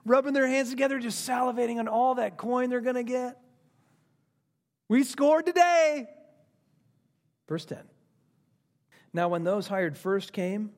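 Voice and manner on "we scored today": high, drawn-out victory crow; gleeful triumph. Acted. Workers waiting in line for coin, acted glee. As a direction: celebrate a big win to your pals. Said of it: "big smiles on their faces".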